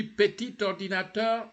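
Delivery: PETIT ORDINATEUR with the T carried over; liaison. Shown as a demonstration. Teacher has learners say PETIT ORDINATEUR, liaison. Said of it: "Say after me".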